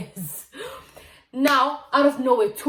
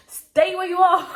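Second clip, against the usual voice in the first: laughing while quoting grandma; higher, louder, broken up by laughter; laughing through words; 9 semitones higher, 9 dB louder, slower than usual. Real, not acted.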